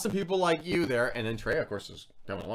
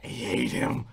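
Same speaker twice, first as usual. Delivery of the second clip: unvoiced aside, pretend secret; mock behind-the-back whisper; 3 semitones lower, slower than usual. Two-faced colleagues behind his back, mock whisper.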